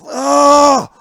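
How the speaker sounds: long, loud, high "ah" scream; horrified scream. Acted. Fan recalling the one photo, horrified scream. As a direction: scream in hammed-up horror.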